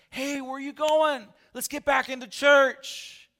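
high, loud, slow call after them. Retold or imitated. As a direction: shout to stop them leaving. Pastor calling after leaving couple.

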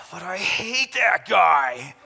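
loud, slow, bitter mock grudge; imitating bitter anger. Pastor voicing a man who can't let go, loud slow mock anger. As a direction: spit out the grudge, dragging it. Angry man voiced, louder.

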